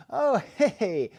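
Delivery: high, loud, laughing hey; big mock-delighted greeting with a laugh; over-friendly party greeting. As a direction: greet your ex way too cheerfully, pitched right up.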